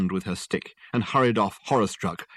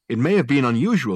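defensive Richard; 4 semitones higher, 6 dB louder than usual. Acted.

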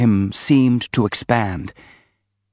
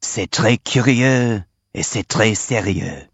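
slow, drawn-out stage hiss; hissed French aside; slower than usual. A narrator's reading. Old man hissing across the table to Ivan Petrovich, slow and emphatic. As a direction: hiss it slowly, meant to carry. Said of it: "he hissed across the table".

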